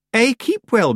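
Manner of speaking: high, over-careful courtesy; icily polite. A narrator's reading. Missus Earwig answers Granny's greeting, icily polite.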